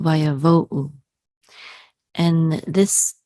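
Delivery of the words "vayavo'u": Vayavo'u sounded out with a final "oo"; pronunciation demo. Shown as a demonstration. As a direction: say Vayavo'u with the last U as its own "oo". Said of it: "Together we'll say U".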